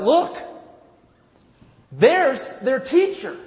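high, loud call, slow on the words; a person in the crowd spots the teacher, calling out; excited pointing out. Acted. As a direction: point and shout across the crowd.

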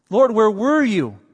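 high, loud accusing cry; sisters' blame. Retold or imitated. The grieving sisters blaming Jesus, in a high, loud accusing cry. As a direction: hurl the question at the Lord.

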